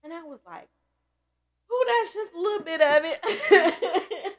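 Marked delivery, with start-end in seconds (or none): 1.7-4.4 high, loud, slow teary laugh; real crying laughter, high and loud; laughing through tears. Real, not acted. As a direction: laugh and cry the line at once. Laughing while crying, retelling.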